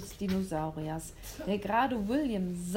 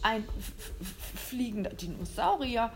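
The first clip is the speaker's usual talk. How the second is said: stuttering, dumbstruck stammer; a hunter too shocked to speak. Acted. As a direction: stumble over the f-. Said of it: "stotterte er völlig verdattert".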